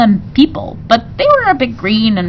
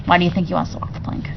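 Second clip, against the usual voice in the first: quick aside; 7 dB quieter, much faster than usual. A narrator's reading. Aside, fast and quiet.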